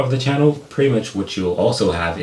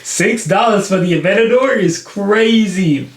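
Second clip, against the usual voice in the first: excited; 10 semitones higher, 3 dB louder than usual. Real, not acted. Excited about a cheap Lamborghini.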